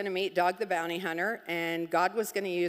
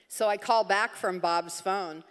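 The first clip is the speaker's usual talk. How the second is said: ordinary delivery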